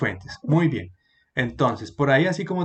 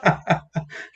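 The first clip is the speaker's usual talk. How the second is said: short breaking chuckle; real laughter. Real, not acted. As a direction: laugh mid-instruction, still tickled. Teacher still laughing at misprint. (risita sincera que interrumpe la frase)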